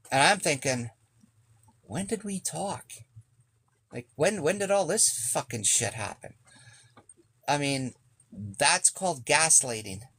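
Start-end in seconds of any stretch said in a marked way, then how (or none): 1.8-6.3 retold bewilderment, voice lifting higher; incredulous inner questioning, high at the start; baffled disbelief. Baffled inner reaction to being gaslit.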